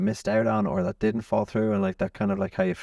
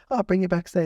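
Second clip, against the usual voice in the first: much higher mimicked mother; breezy offer in a pitched-up woman's voice; obliging mother; 13 semitones higher than usual. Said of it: "she's like".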